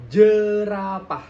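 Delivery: "jerapah" slow, with a pushed J; J bergetar di dada. Shown as a demonstration. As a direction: articulate "jerapah" from the chest. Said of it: "di dada itu ada sedikit getaran". ("jerapah" dengan J ditekan)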